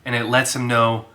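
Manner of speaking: ordinary talk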